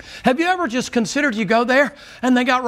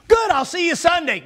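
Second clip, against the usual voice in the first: triumphant retort; 7 semitones higher than usual. Retold or imitated.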